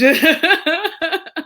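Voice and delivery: high bright laugh burst; real burst of laughter. Real, not acted. Reacting to Joe's joke, bursting into laughter. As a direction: crack up for real.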